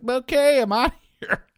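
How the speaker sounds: high, loud imitated sign-off; mock hasty exit. Acting the lady bailing out.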